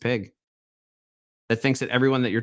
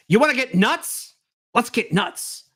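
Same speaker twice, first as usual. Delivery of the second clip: Michael Keaton impression; 8 semitones higher, slower than usual. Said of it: "Michael Keaton in, Batman nineteen eighty-nine".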